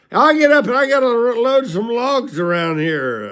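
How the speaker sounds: put-on loud, high protest of a woken drunk; put-on high drunken holler; imitated drunk boss. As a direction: act out a drunk insisting on working.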